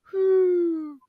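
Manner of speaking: high sustained whoo; imitated flying whoosh. Imitating Eternals flying on wires, a high whoo. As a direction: mimic the swoosh of flight.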